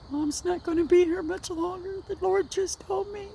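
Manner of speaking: very high, tearful-style wail retold; imitated bawling. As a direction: wail it as if bawling to a husband on the phone. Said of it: "and I'm bawling".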